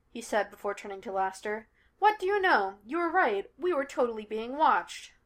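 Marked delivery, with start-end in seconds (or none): 2.0-5.1 mock amazement. A narrator's reading. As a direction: tease him with exaggerated discovery. Sean mockingly concedes to Laster.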